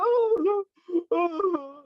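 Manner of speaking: very high, whiny mock cry; hammed-up whining; mock whimper. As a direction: parody a fighter who gets hurt too easily. Mocking opponent's whine.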